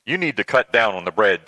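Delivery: ordinary delivery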